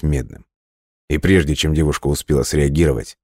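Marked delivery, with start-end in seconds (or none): none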